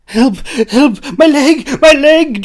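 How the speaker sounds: very high, loud cries of pain; shrieking. A narrator's reading. Jack thrown and injured, shrieking for help. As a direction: scream for help in agony. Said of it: "Jack shrieked".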